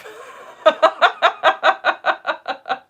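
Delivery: high burst of laughter; laughing. Real, not acted. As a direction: let a real laugh break out. Laughing at own joke.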